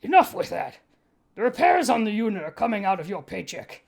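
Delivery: a lightly voiced stern captain; captain's voice. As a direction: scold him as the captain. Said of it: "the captain called".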